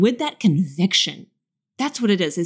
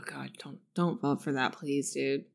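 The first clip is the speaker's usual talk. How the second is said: whispered, pleading; soft whispered plea; 4 semitones lower, 11 dB quieter than usual. Real, not acted. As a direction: beg quietly, almost a whisper. Pleading softly.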